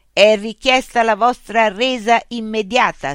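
loud, high mechanical announcement; robot policeman. A narrator's reading. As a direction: issue an official demand as a machine. Robot policeman issues official demand.

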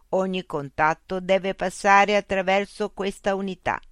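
machine voice. Acted. Loudspeaker robot voice addressing Mitch. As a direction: speak as a polite, mechanical information unit. Machine refuses direct contact.

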